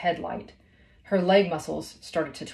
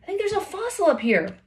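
high, eager call; a high call about a fossil; excited call; 12 semitones higher than usual. A narrator's reading. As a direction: share the discovery with a friend. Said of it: "Haley called".

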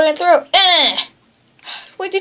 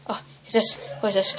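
ordinary talk, nothing marked

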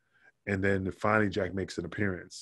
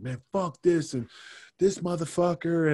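higher-pitched retold griping; imitated cursing; 7 semitones higher than usual. Wrestler venting to a colleague about the new finish, mock cursing. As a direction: act out his cursing without much extra volume. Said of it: "cursing, man".

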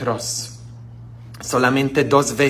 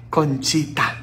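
tender; 5 dB quieter than usual. Shown as a demonstration. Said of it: "Es como decir Dieguito".

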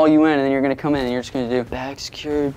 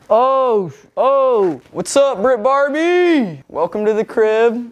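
starstruck greeting; 9 semitones higher, 4 dB louder, much slower than usual. Real, not acted. Greeting the arriving star.

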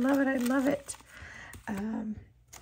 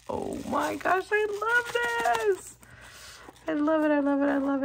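high cooing; 8 semitones higher than usual. Real, not acted. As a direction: melt over it in a high coo. Cooing at shaker.

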